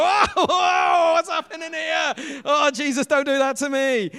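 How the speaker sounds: high, loud panicked cries; terrified shout. Acted. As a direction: yell it out like you've seen a ghost. Disciples terrified by Jesus appearing.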